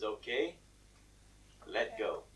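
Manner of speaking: very soft, low command; voice of God. Acted. God instructing the hanging man, low and matter-of-fact. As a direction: drop it low and quiet. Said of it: "And the Lord says".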